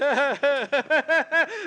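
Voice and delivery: high, derisive cackle; mocking laugh. [name] laughing at Leo, mocking cackle. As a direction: laugh at him cruelly.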